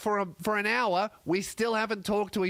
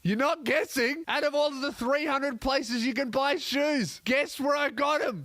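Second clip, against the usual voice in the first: insistent quizzer; 7 semitones higher than usual. Acted. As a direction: badger the listener into guessing. Plays someone insisting on guesses.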